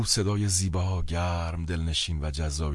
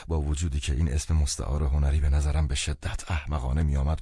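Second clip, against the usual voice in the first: ordinary delivery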